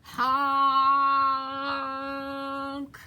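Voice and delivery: long, held honk on one note; yelled truck honk. A narrator's reading. Dump truck yelling to come through, long honk. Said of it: "yelled the dump truck".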